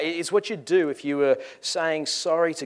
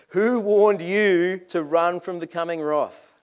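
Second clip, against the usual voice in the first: slow, raised-voice challenge; loud accusing demand; 4 semitones higher, 7 dB louder, slower than usual. Acted. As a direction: thunder it at the newcomers. John berating the arriving leaders.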